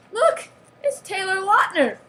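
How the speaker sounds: high, loud exclamation; mock-thrilled announcement; excited discovery. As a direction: cry out at spotting a celebrity. Spots a star in magazine.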